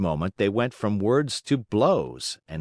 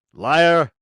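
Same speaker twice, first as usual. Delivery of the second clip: loud, higher yell; shouted insult; 6 semitones higher, 9 dB louder than usual. A narrator's reading. Antonio yells at Geppetto, loud. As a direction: spit out liar. Quarrel heats up.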